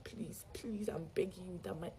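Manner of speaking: soft, retold pleading; begging. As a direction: act out a hushed plea. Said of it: "I was still begging that".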